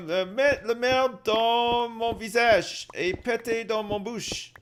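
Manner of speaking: very high, loud, slow screeching French; an absurd shrieked French accent; over-the-top French. Pretend. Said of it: "speak to them in their native tongue".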